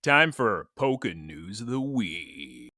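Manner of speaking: slow, low, stretched title call; segment announcer. Pretend. Host opening the weekly news segment, slow mock announcer. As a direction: announce the segment with drawn-out words.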